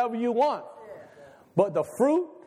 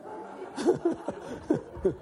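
breathy, voiceless chuckle; laugh; 2 semitones higher, 6 dB quieter than usual. Real, not acted. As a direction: let out a long, wheezy laugh. Amused after his own joke.

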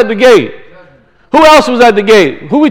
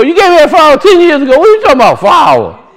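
put-on fast, raised, higher disbelief at an old debt; mock indignant what-are-you-talking-about; incredulous retort; 6 semitones higher, faster than usual. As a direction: scoff at being reminded, quickly.

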